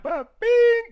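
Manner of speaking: high, loud ba-bing; excited ba-bing. Real, not acted. Celebrating his winning trade with a high loud cry. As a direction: belt out the win sound.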